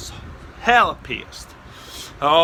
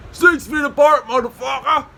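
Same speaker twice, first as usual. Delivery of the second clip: high, loud call from hiding; taunting holler; 8 semitones higher, 4 dB louder than usual. Retold or imitated. Hidden prankster answering the cops, taunting shout. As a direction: holler it as a cheeky heckle.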